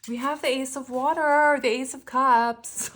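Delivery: high, loud sing-song announcement; playful high-pitched reveal; funny high voice. Real, not acted. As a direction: squeal the card name out. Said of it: "I don't know why I say it like that".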